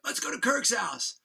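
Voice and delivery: drawn-out, high rallying call; mock excited shout, pitched high; excited party cry. Drunk friend proposing a great idea, excited high call.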